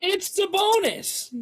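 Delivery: high, slow sing-song reveal; mock reveal. Real, not acted. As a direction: draw it out teasingly.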